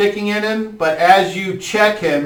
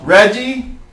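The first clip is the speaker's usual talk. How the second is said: ordinary talk, nothing marked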